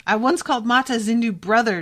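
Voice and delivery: a lightly voiced furious scream at the captain. A narrator's reading. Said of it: "Gia had screamed at the Kakru captain".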